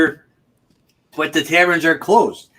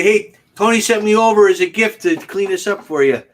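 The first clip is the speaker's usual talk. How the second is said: pretend pool cleaner; 7 semitones higher, 4 dB louder than usual.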